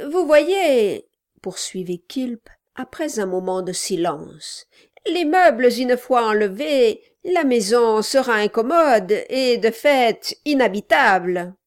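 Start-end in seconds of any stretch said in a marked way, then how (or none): 0.1-1.1 loud, higher dwarf voice; a loud, pushy little man; gruff gravelly dwarf voice. Acted. Quilp speaks. 5.1-11.5 loud, higher dwarf voice; a loud, pushy little man; gruff gravelly dwarf voice. Acted. Quilp speaks.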